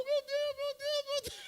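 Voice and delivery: very high, shrill-pitched cry of amazement; astonished outcry. Retold or imitated. Healed man crying out to God, very high. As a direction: cry out as if seeing a miracle.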